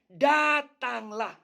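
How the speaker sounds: the emphasised Datanglah, drawn out; stressed Datanglah. Shown as a demonstration. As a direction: stretch out Datanglah as the point. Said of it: "Karena penekanannya".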